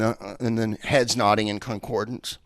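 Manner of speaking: ordinary talk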